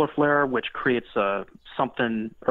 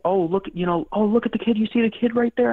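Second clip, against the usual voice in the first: high-pitched mock excitement, climbing as it goes; imitating excited spotters; 10 semitones higher, 4 dB louder than usual. Intuitives telling the team they see a kid, imitated excitement. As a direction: act out someone eagerly pointing out a sighting.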